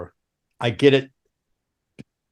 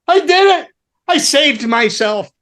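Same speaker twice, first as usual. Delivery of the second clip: high, elated mock cry of relief; retold triumph; 12 semitones higher, 4 dB louder than usual. Musician congratulating himself after a lucky guess, high and triumphant. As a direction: cheer your own narrow escape, voice pitched up.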